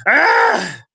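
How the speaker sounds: short high loud scream; mock scream. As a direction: shriek in playful horror. Mock horror at the sign.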